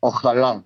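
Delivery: "Ojalá" said the Spanish way, o-kha-LA with a throaty kh; "Ojalá" with Spanish j as a rasp. Shown as a demonstration. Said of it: "they say ojalá".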